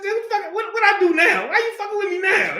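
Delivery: very high-pitched mock protest; David's exasperated comeback. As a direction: squawk it like a wronged younger brother. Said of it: "that's how we would say it today".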